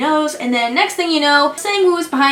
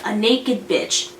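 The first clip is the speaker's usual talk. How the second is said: ordinary delivery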